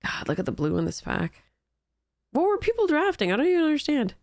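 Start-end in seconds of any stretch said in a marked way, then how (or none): 2.3-4.1 incredulous. Real, not acted. Baffled by the draft picks.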